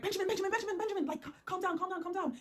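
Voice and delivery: frantic pleading. Retold or imitated. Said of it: "And I'm like".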